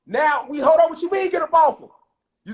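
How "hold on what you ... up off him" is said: indignant retort. Retold or imitated. Retorting to the peacemakers.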